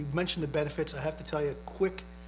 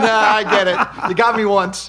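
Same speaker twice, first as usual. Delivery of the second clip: laughing concession; 6 semitones higher, 16 dB louder, slower than usual. Retold or imitated.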